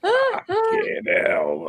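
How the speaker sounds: high, stretched laughing outburst; laughing exclamation. Real, not acted. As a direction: cry it out through laughter. Reacting to licorice joke.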